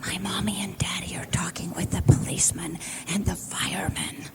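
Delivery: tiny whispered no; hushed child's whisper. Retold or imitated. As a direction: whisper it like a small child hiding. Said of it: "And still whispering".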